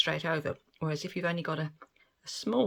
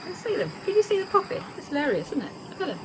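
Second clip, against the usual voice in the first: much higher, louder delighted squeal; can barely contain the glee; gleeful squeal; 11 semitones higher, 4 dB louder, much faster than usual. Real, not acted. Gleeful at bubbles popping.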